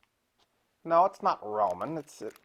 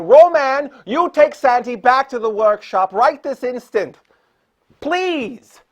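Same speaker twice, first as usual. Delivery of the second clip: raised, high-pitched command; urgent scolding order; 6 semitones higher, 12 dB louder, slower than usual. Acted. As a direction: order a bungling helper about, exasperated. Host orders Roman to help Santa.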